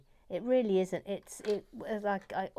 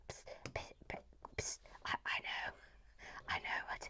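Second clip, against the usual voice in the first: whisper; 11 dB quieter than usual. Acted. Conspiratorial whisper to the viewers.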